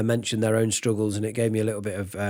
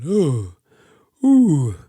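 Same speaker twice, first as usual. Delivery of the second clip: dark dopey ooh, larynx down, louder; dopey ooh sound; 4 dB louder than usual. Shown as a demonstration. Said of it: "this dopey sound".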